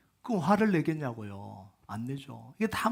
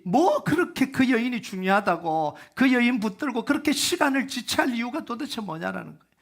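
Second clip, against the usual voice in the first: higher, louder, hurt and indignant pleading; a desperate father protesting to Jesus, higher and louder; voicing Jairus's bitter complaint; 6 semitones higher, 4 dB louder than usual. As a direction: let the betrayed father's resentment spill out. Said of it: "이런 마음들이 안 들겠어요?".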